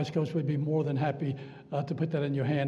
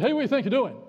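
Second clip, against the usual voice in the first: retold indignant challenge, raised pitch; higher, mock-angry demand; mad pastor; 6 semitones higher, 4 dB louder, faster than usual. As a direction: imitate a preacher calling out a walkout. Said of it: "made the pastor mad".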